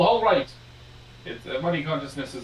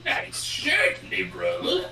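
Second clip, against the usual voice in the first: Joker; 6 semitones lower, slower than usual. Acted. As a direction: taunt like a comic-book madman. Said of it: "supposed to be the Joker".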